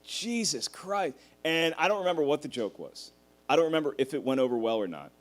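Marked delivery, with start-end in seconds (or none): 0.0-1.2 inner dread. Retold or imitated. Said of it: "I remember thinking".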